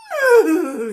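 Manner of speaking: loud, high mock moo; hammed-up loud moo; mooing horse. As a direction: moo it out big for the joke. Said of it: "said the horse".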